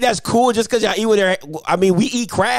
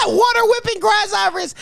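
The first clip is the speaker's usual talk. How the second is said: laughing shout; 10 semitones higher than usual. Real, not acted. Host cracks up mocking the caller.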